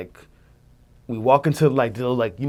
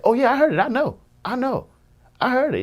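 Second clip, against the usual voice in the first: very high, insistent recognition; high-pitched and keen, repeating it; retold eager agreement; 13 semitones higher, 3 dB louder than usual. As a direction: imitate someone excitedly cutting in.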